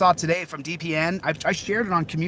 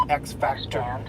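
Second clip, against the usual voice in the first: ordinary delivery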